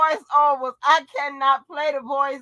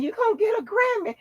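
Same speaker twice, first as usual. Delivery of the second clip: much higher, mock-gushing quote; mimicking the voiceover; 10 semitones higher than usual. Host quoting the Jennings voiceover, high mimicry. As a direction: squeak it back in a high voice. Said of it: "And he done said".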